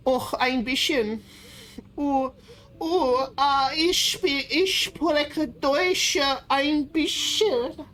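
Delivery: high, slow hammed-up German accent; mock German accent. Streamer hams up German for chat, in a mock German accent. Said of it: "real German accent".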